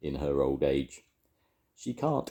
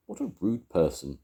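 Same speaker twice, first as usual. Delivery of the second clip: ordinary delivery, nothing marked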